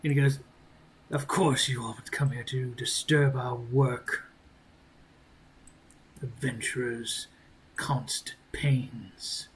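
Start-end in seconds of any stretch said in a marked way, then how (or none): 1.1-9.5 weary game character. Acted. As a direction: grumble it, fading away by the end. Game master plays a character.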